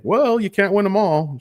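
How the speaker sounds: high, bright dad voice; chirpy fatherly consolation; Jack Chester voice. Retold or imitated. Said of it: "He's like".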